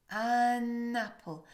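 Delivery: "An apple" said very slowly with an open, rounded vowel; slow apple demo. Shown as a demonstration. Teacher slowly linking the French sound to English. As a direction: draw "An apple" out to show the vowel.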